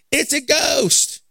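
high, drawn-out cry of alarm; mock fearful cry. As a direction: cry out in hammed-up fright. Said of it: "cried out in fear".

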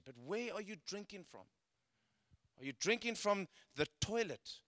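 normal talking voice

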